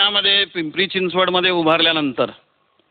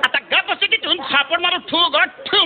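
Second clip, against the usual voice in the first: scolding brother on the phone; 7 semitones higher than usual. Retold or imitated. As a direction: tell her off then calm her. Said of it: "फक्त ताईच म्हणाला".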